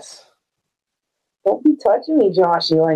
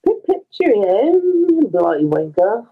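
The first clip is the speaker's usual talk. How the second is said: put-on British accent; 4 semitones higher, slower than usual. Mock British posh insult.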